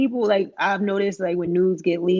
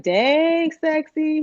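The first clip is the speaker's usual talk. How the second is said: 1 catcalling out window; 7 semitones higher, much slower than usual. Retold or imitated. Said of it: "calling out the window like".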